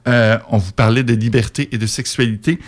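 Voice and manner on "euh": usual speaking voice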